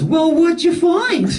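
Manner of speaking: put-on high, slow little old lady voice; sweet old lady asking what he found. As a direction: chirp it like a nosy grandma. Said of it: "this little old lady goes".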